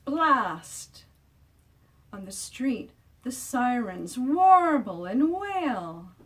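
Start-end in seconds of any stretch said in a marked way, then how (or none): 3.2-6.1 higher, louder, slow wail like a siren. A narrator's reading. Reading to kids, wailing like street sirens. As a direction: let the words warble like a siren.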